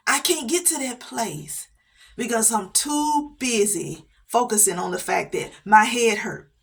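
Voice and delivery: usual speaking voice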